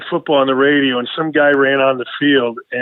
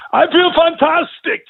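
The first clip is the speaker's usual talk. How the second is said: put-on high, drawn-out British accent; mock British accent; 13 semitones higher than usual. Man showing off his post-surgery video, mock British accent. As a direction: put on a hammy, high-pitched British voice, slowly. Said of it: "I only spoke in a British accent".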